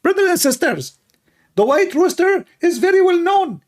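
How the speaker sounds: high, squeaky parody of a preacher addressing his flock; Ali Dawa. Acted. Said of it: "Let us ask Ali Dawa why".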